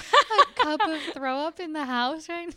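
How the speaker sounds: high, loud and drawn out through laughter; laughing disbelief. Retold or imitated. Telling friend about the leftover mug, laughing in disbelief. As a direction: crack up while quoting the horrified reaction. Retelling gross discovery amid laughter.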